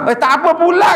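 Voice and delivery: high, loud mock beggar shout. Beggar loudly refuses the offer, hammed-up high shout.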